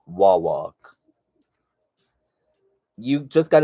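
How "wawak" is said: Wawak said as "waw-walk"; "waw-walk" for Wawak, matter-of-fact; official pronunciation. Shown as a demonstration. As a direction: state Wawak clearly, ending like "walk". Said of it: "They officially told me it is".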